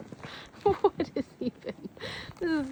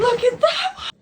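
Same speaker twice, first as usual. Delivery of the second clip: high, loud, thrilled cry; excited shout; 11 semitones higher, 7 dB louder than usual. Real, not acted. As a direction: shout with delight at the find. Excited shout at big shovel.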